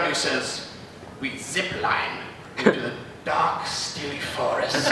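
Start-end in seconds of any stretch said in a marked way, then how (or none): 1.2-4.9 hushed, slow creepy narrator voice; lightly voiced old horror host; 1950s horror villain. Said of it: "a nineteen fifties horror villain voice".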